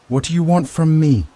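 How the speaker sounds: high, loud panicked plea; scared cry into the radio; terrified. A narrator's reading.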